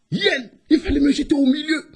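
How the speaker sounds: fast, high-pitched whining hare; high complaint. Acted. Hare scolds the returning hyena, high fast complaint.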